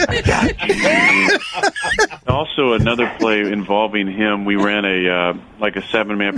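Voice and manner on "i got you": high, cackling mock laugh through the words; imitating a maniac laugh. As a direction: cackle it out slowly like a madman. Teammate cackling at the narrator.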